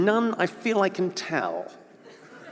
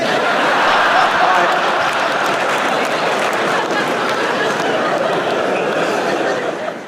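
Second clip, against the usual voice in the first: long cackling laugh; 3 semitones higher, 5 dB louder than usual. Real, not acted. Comedian cracking himself up at his restraining-order joke, long cackle. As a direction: let a long unvoiced cackle roll.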